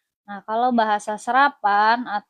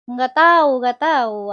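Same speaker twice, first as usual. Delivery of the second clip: louder, playful protest; 7 dB louder than usual. Real, not acted. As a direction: shout it out, teasing. Playful outburst at chat. (teriak bercanda)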